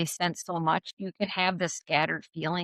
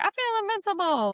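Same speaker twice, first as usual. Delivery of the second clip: mock triumphant; 13 semitones higher than usual. Mocking a boastful feeling.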